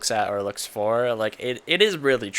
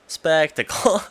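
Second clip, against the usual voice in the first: spectacle, stretched out with a rising finish, a little louder; drawn-out spectacle; 6 semitones higher, 5 dB louder, slower than usual. Shown as a demonstration. Host prompting co-host to say spectacle together, slightly louder rising. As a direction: announce spectacle like a catchphrase. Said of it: "and say it with me now".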